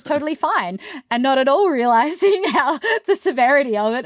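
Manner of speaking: high, chuckling through the sentence; laughing while talking. Real, not acted. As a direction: let real laughter break into the words. Laughs while telling of mum's unawareness.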